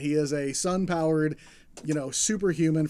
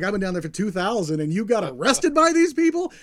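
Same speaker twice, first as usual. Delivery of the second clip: louder, rising to an incredulous high; angry disbelief; 5 semitones higher, 8 dB louder than usual. Retold or imitated. God scolding Jesus for getting arrested, angry and loud. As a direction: scold him in outraged disbelief. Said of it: "God is angry".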